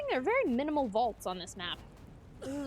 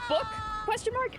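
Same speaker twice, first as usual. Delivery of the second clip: "book, question mark" lifted high at the ends; 11 semitones higher than usual. Retold or imitated. Tourist translating the question for listeners, questioning. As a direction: make "mark" shoot up like a question.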